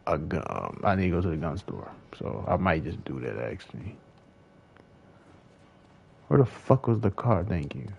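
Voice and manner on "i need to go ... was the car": ordinary talk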